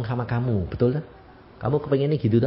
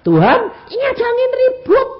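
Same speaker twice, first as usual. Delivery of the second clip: high, loud cry for help; acted urgent shout; shouted prayer; 7 dB louder than usual. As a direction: shout up to God about the storm. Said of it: "kamu teriak".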